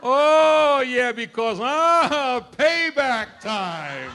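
loud, high, slow relish; gleeful laughing. Real, not acted. Grandfather gloating about kids zinging their parents, laughing. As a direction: savour the payback with a laugh. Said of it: "gleeful grandparent".

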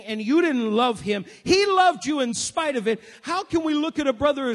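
building from steady to loud, high preaching; growing sermon fervour; rising preaching. Acted. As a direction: preach it harder line by line.

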